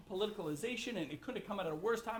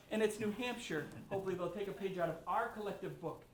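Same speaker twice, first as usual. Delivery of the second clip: ordinary talk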